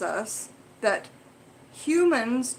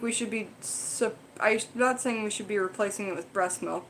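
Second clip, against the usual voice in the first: ordinary delivery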